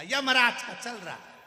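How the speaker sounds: loud, high angry snap; sick man furious at the deaf visitor; angry retort. Acted. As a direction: fling it back in fury. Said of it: "अब इसको आ गई गुस्सा".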